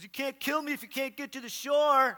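loud, high taunt across water; taunting yell. Retold or imitated. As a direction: goad your brother from the shore. Brother taunts from the shore.

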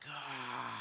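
long, soft breathy "ah"; awed gasp. Retold or imitated. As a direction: exhale in mock wonder at your own hands. Awed look at own hands.

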